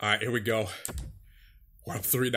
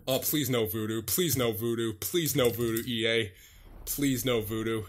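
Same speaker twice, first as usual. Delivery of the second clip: soft, lowered repeated begging; low pleading; 4 semitones lower, 5 dB quieter than usual. Real, not acted. Player begs E A before overtime, hushed pleading. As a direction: plead under your breath, like a nervous prayer.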